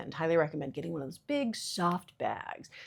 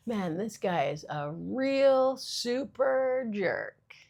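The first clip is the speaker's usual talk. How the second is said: slow, higher laid-back surfer drawl; lazy beach-dude delivery; 5 semitones higher, 3 dB louder, much slower than usual. Shown as a demonstration. Comic demos swapping swear words for super, surfer-style. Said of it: "I'm just gonna change it to super".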